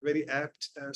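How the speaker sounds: normal talking voice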